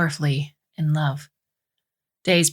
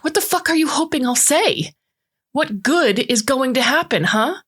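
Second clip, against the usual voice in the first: high, angry outburst; heated scream, contained in volume; 9 semitones higher than usual. A narrator's reading. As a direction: demand it in a high, furious pitch. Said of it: "she screams".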